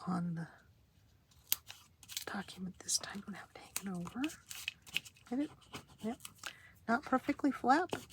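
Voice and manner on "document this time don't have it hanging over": whispering. Real, not acted.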